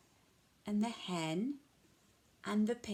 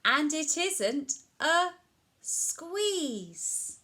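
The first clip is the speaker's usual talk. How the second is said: high lilting read; delighted; 7 semitones higher, 4 dB louder, much slower than usual. Acted. Storyteller telling children, high and delighted. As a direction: lift it up with delight.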